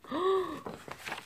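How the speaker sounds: high sharp mock gasp; a shocked little gasp. Narrator gasping at the rude class.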